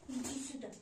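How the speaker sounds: ordinary talk, nothing marked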